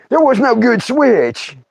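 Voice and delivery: normal talking voice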